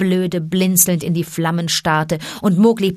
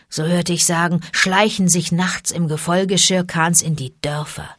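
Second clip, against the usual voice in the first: ordinary delivery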